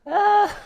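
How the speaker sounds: high, loud "ah!" after a laugh; pained cry. Real, not acted. Drummer worn out by too fast a tempo, high cry.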